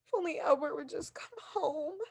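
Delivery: soft, tearful plea; breaking down into tears; crying. Acted. Invalid weeping for her absent husband, softly.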